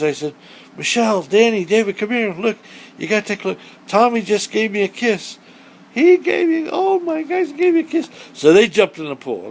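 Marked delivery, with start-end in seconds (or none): 0.8-5.4 higher, eager call across the pool; calling the kids excitedly. Retold or imitated. Mother calling her kids over to the pool, excited. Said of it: "I yelled at the kids". 5.9-8.1 very high, squealing with delight; thrilled squeal. Retold or imitated. Telling the kids the turtle kissed her, squealing delight. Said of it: "I yelled at the kids".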